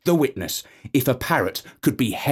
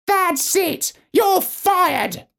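much higher parrot shout; parrot calling out; much slower than usual. Acted. Parrot firing his lawyer in court, high squawk. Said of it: "called out the parrot".

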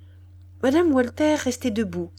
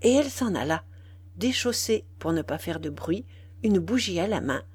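plain reading voice

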